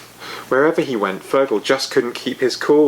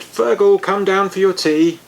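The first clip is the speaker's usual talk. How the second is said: dad calling much louder and higher; 9 semitones higher, 5 dB louder than usual. A narrator's reading. Dad calling Fergal down for his tea. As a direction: call him down for tea across the house. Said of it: "Like when his dad said".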